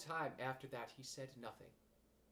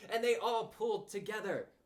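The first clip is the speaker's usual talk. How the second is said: ordinary delivery, nothing marked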